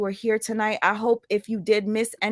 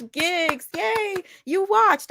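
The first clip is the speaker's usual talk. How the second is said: much higher, thrilled cry of joy; overjoyed at a viewer; delighted squeal; 11 semitones higher, much slower than usual. Real, not acted. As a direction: squeal with real delight at a fan.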